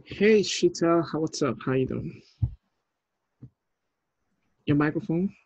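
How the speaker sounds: ordinary delivery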